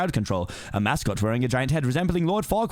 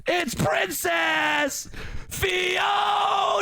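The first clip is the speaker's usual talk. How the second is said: high, drawn-out announcer shout; grand host shout; much slower than usual. Acted. Mirror unveils Princess Fiona to the lord, long high shout. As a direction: stretch out the big reveal. Big reveal of the bachelorette.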